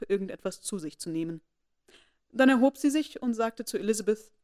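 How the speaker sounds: ordinary delivery, nothing marked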